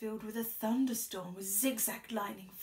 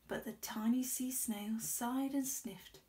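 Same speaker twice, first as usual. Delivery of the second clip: usual speaking voice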